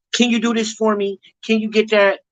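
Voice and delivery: high, sweet mock request; high teasing girlfriend voice; mock girlfriend asking. Imitating a woman's requests.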